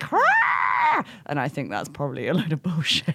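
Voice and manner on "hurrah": very high raptor screech; imitated raptor call. Host mimicking a blown raptor larynx, screeched call. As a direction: let out a high, blaring dinosaur cry. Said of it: "and it being like".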